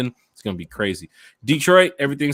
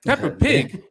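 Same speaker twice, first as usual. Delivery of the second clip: put-on high, indignant British-accented question; mock British accent; 11 semitones higher, 4 dB louder, slower than usual. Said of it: "was that your Peppa Pig accent".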